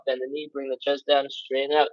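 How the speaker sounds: rushed count. Shown as a demonstration. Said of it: "I went through those real fast".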